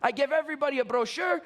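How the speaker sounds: put-on high, excited nonna with Italian accent; Italian grandmother. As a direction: imitate grandma boasting about her sales. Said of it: "She says".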